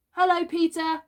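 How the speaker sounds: high, loud hello; bright-eyed children hailing a friend; chorused greeting. A narrator's reading. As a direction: greet in a high, eager singsong. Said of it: "they both said".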